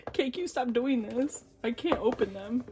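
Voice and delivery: normal talking voice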